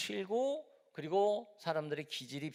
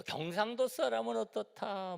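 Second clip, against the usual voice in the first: much higher, slow mock gossip; quoting regional gossip; 11 semitones higher than usual. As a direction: lightly play a gossiping neighbour. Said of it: "뭐 이런 얘기도 합니다".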